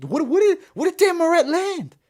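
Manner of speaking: very high-pitched stammered disbelief; incredulous. Real, not acted. Streamer questioning how the judges scored it, incredulous.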